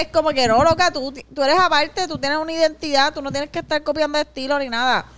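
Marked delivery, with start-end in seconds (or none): none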